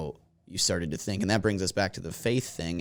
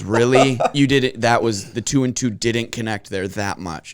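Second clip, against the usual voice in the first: louder sarcastic disbelief; mock sarcasm; 3 semitones higher, 6 dB louder than usual. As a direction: needle the cashier with fake amazement. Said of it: "and he goes".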